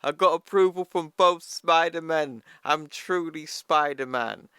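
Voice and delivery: high, loud, giddy cheer; all excited. Retold or imitated. Miles cheering after both Spider-Men approve him, high and loud. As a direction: squeal it like a fan who just got the nod. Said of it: "Miles is all excited".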